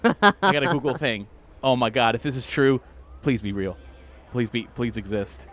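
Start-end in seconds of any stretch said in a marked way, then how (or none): none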